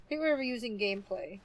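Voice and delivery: normal talking voice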